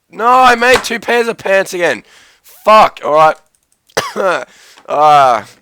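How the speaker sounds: high, loud frustrated outburst; exasperated yell. Real, not acted. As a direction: cry out in sheer annoyance. Messed up armor crafting.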